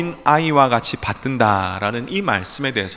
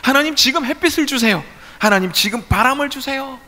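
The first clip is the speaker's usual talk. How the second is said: high-pitched, repetitive pleading demands; insistent demanding prayer; 11 semitones higher than usual. Acted. Farmers demanding weather from God, high insistent voice. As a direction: press God urgently for each request. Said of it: "하나님께 막 요구합니다".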